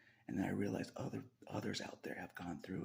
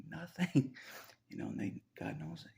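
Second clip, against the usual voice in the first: ordinary talk, nothing marked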